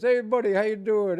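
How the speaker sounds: loud, high Brooklyn greeting, imitating the guy; Brooklyn accent. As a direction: belt it out like a Brooklyn local saying hi. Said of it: "with the Brooklyn accent from years ago".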